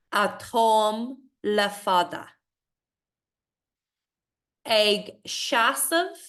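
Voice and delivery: slow, measured recitation; poem reading. Acted. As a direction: read each word carefully, with pauses. Reads the poem aloud.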